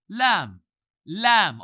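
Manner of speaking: "Lamb." loud with the tongue open; stressed lam. Shown as a demonstration. Teacher drills lam with students. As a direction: ring the l of "Lamb.". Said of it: "Open up your tongue".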